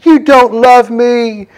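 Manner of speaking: high, very slow, drawn-out sulky whine; whiny Delilah. Retold or imitated. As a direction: stretch it out like a pouting lover. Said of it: "the manipulation tactic comes in".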